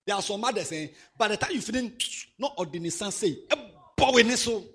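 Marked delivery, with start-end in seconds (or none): 3.5-4.6 put-on sharp high shout at the boy; a mother snapping at her son; mother's scolding shout. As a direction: bark it like a cross mother.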